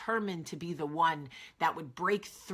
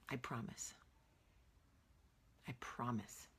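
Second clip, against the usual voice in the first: whispered promise; 5 semitones lower, 14 dB quieter than usual. Real, not acted. Tender reassurance to viewers.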